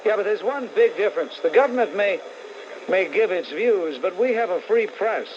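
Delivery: ordinary delivery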